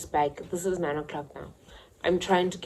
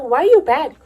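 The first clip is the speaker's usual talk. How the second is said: surprised question; 10 semitones higher, 13 dB louder than usual. Retold or imitated. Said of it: "she's like".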